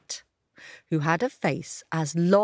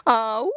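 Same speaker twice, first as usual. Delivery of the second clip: loud dog howl sliding upward; mournful ah-woo; 4 semitones higher, 5 dB louder than usual. Acted. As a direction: howl out like a sorry hound. Imitates the dog howling.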